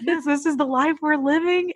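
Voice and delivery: high, resigned sigh of a line; resigned mom. Retold or imitated. Mom telling coworkers, resigned and high. End of the Zoom reply.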